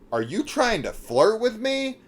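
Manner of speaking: high-pitched, mock-incredulous question; imitating the caller. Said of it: "she was like".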